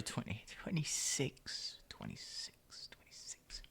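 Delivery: whisper, slowly fading out. Real, not acted. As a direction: mutter the number away to nothing. Self-correction fading to whisper.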